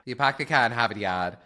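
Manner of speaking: put-on dropped-R Boston accent, pahk the cah in Hahvahd Yahd; mock Boston accent. Trading accents with her cohost, a broad Boston accent. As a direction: lay on the Boston accent, no R sounds. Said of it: "in Boston".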